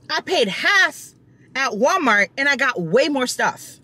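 high, aggrieved rant; exasperated rant. Real, not acted. Vlogger ranting to her viewers about grocery prices, high and exasperated. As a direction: vent it high and fed up. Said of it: "I'm so annoyed".

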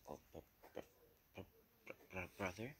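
quiet, halting stutter before the word lands; stunned, broken-up stammering; shocked stammer. Retold or imitated. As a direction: stumble over the first sound, then question it. Shocked stammer at surprising news.

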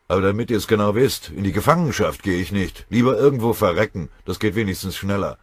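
loud, forceful refusal; growling defiance. A narrator's reading. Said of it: "knurrte Beutelschläger". (trotzig und laut geknurrt)

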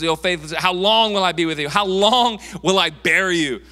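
loud, high, mock-scolding rebuke; exasperated outburst. Jesus fed up with his disciples, loud exasperation.

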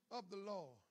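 ordinary talk, nothing marked

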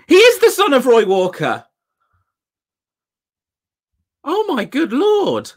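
high, loud burst of disbelief; astonished. Real, not acted. Finding out the host's famous father, astonished. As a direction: exclaim it, really amazed. Discovers host is Roy Walker's son.

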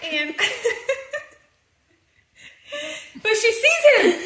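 giggling through the words; can't stop laughing while telling. Real, not acted.

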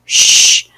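"Sh" as a long unvoiced hush; a drawn-out shushing "Sh", like quieting a room. Shown as a demonstration. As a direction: hush someone with "Sh". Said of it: "como el sonido cuando uno quiere callar a alguien".

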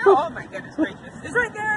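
being chased. Real, not acted.

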